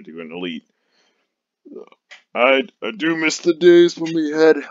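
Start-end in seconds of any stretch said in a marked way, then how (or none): 2.3-4.6 slow, loud, higher brute bellow; dopey cartoon bellow. Shown as a demonstration. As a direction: boom it out like a dim brute. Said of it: "I can't really do a brute, a brute voice".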